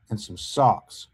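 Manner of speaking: usual speaking voice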